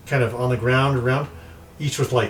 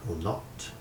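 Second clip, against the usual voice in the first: very soft, eerie spirit voice; hushed, otherworldly warning; mysterious voice; 13 dB quieter than usual. Acted. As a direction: chide them softly like a ghost at their shoulder. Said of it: "you hear a voice".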